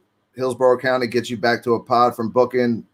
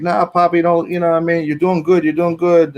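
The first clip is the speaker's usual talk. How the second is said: higher, louder pleading encouragement; coaxing coworkers; 6 semitones higher, 3 dB louder than usual. Retold or imitated. Guards talking the new guy out of quitting, pleading. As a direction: cheer him on so he won't quit. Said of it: "And they were like".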